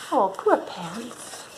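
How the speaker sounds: slow, soft and tender; tender pity. Acted. Pitying the crying pants, soft tender coo. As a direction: coo it like comforting a scared child.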